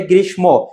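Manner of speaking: গ্রীষ্ম said "grishmo", with the m sounded; common mispronunciation. Shown as a demonstration. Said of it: "অনেকে উচ্চারণ করে".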